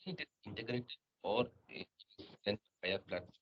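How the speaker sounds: very faint, far-off voice; speaking much too softly; barely audible. Real, not acted. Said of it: "Your voice is very low".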